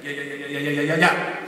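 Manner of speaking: nervous stammering repetition, played for laughs; mock-nervous stuttering friend; imitating a stutter. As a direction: stumble over the yeahs like you're nervous. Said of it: "And he's stuttering".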